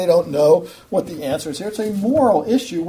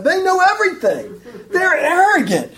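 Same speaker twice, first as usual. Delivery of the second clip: laughing, pitched up high; 9 semitones higher than usual. Real, not acted. Preacher mocking the Pharisees' arrogance, laughing. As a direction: laugh through the words in scorn.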